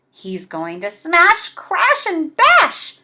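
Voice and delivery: rising high on crash and bash; excited cry. Acted. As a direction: cry out the mayhem with glee. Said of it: "cried Gloom and Doom".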